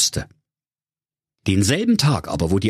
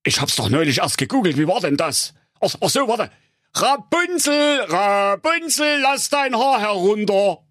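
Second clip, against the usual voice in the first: much higher put-on suitor voice, calling up; Enrico; 12 semitones higher than usual. Enrico calling up to the tower, high put-on voice. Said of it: "und rief".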